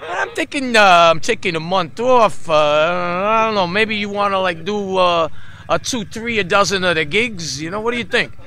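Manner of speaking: loud, high-pitched heavy New York impression; thick New York accent at high volume. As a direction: imitate a funny New Yorker, big and loud. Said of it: "Spoke with this really heavy New York accent".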